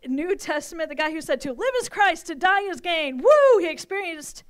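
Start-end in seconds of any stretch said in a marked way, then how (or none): 1.4-3.2 fervent proclamation. Acted. Quoting Paul with fervour. 3.2-3.6 a loud, high, joyful cheer; excited whoop. Real, not acted. Cheering after the Paul quote.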